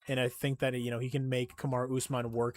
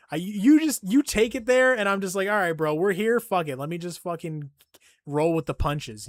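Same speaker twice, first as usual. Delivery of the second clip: loud protesting; 4 semitones higher, 7 dB louder than usual. Real, not acted. Defending himself to chat.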